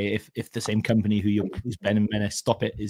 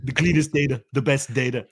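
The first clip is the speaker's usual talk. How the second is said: Trump impression; 4 semitones higher than usual. Joins the Trump bit.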